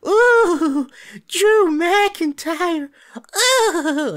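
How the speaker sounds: loud, high falsetto fanboy squeal; mock the fans with a drawn-out high swoon; hammed-up swooning fan voice; gushing fans. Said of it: "Some of you guys, you'd be like".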